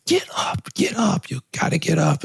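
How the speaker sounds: coaches' yell retold in a hushed whisper; retold scream, whispered. Coaches urging the fallen boy, in a whisper instead of a scream. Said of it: "The coaches are screaming".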